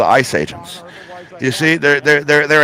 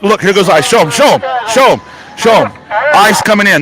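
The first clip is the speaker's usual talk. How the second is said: urgent excitement; 6 semitones higher than usual. Real, not acted. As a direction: hurry them to point the camera. Reporter urging crew to film agents.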